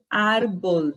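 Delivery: "árbol" with an open a and a b; Spanish árbol. Shown as a demonstration. Teacher giving the Spanish word for tree. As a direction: model "árbol" clearly. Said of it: "Palavra árvore em espanhol".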